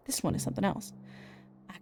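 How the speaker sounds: hushed, breathy secret whisper. Acted. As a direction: lean in and whisper it. Hushed secret about the photo.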